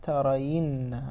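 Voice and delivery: "وإما ترين" drawn out at half speed; slow demonstration. Shown as a demonstration. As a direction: stretch "وإما ترين" syllable by syllable. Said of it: "فنحرك الياء فيصير".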